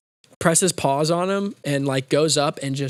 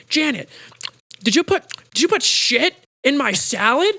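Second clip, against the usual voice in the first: much higher, hammed-up outraged query; boss calling secretary; 10 semitones higher, 3 dB louder than usual. Boss calling out to secretary, high mock outrage. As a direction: call out in a high, incredulous voice. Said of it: "calls out for his, uh, his secretary".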